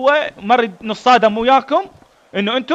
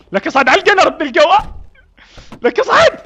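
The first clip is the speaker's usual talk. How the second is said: calling out; 7 semitones higher than usual. Acted. Calling a soldier onto the gun.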